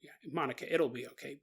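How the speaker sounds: soft, hushed comfort; gently calm a small child; quiet, subdued soothing; reassuring father. Acted.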